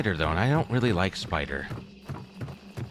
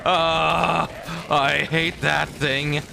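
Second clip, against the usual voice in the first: panicked yelling; 10 semitones higher, much slower than usual. Real, not acted. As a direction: yell in fear while laughing. Fleeing monster, laughing at self.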